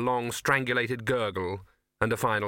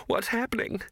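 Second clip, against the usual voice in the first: much higher, dazed and frightened; waking up bewildered; confused; 11 semitones higher than usual. Acted.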